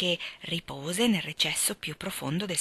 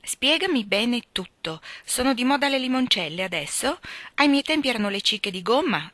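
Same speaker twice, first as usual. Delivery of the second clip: trying to stay serious; 6 semitones higher, 4 dB louder than usual. Acted. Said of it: "cercando di star seria".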